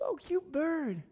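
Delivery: high, soft coo at a pet; cooing admiration. Retold or imitated. Lady admiring a little parrot in the window, cooing.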